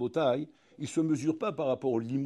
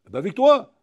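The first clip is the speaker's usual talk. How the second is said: loud, higher, indignant; indignant retort; 6 semitones higher, 13 dB louder than usual. Retold or imitated. The man answering God, loud and indignant. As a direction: throw it back at him.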